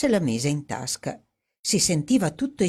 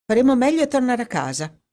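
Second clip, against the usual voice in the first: breezy and offhand, brisk pace, pitched higher; casual, unbothered, a bit quick; 8 semitones higher, 4 dB louder, faster than usual. A narrator's reading. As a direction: toss it off lightly and quickly. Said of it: "disse in tono disinvolto".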